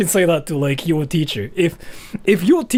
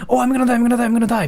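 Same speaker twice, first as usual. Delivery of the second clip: high mock panic; mock-panicked repeat; 6 semitones higher, much faster than usual. Embarrassed person, high mock panic. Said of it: "when people say".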